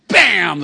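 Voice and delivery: loud, high "bam"; imitated door slam. Storyteller mimicking son's door slamming, explosive bam.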